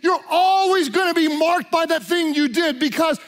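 relentless hammered condemnation, building to a high peak; preacher voicing the accuser with mounting force; devil's accusation. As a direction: pound out each accusation, rising at the end. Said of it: "the devil wants you to believe".

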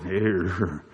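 very low mumbled noise; three guys groaning. Retold or imitated. Three guys watching him get up, low dopey murmur. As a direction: imitate men in a deep, drawn-out murmur. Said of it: "then three guys going".

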